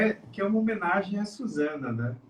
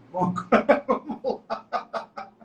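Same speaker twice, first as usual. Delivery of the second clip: laughing; 8 semitones higher, slower than usual. Real, not acted. Laughs while moving on.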